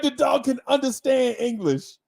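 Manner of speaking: normal talking voice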